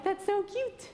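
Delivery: high, sing-song cutesy coo; mock cooing. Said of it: "I'm like".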